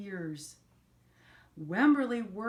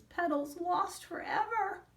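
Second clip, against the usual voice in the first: mock crying; 8 semitones higher than usual. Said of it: "She's crying".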